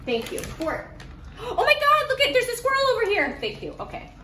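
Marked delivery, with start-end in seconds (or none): 1.4-3.4 fake excitement. Fake squirrel alarm to lure dog.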